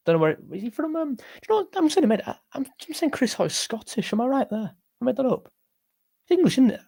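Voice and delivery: a mock mate asking about Chris Hoy; put-on voice. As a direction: playfully ham up a different speaker. Mock voice questioning where Chris Hoy is from.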